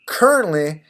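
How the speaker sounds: normal talking voice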